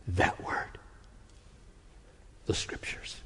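hushed whisper. Real, not acted. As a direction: drop to a whisper. Sermon, sudden hushed words.

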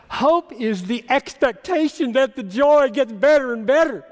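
high, loud, building declaration; impassioned preaching. Real, not acted. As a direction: proclaim it with mounting force.

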